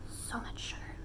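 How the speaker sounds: hushed whisper about the sugar. Acted. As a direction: whisper it like a guilty secret. Whispered repeat of the words.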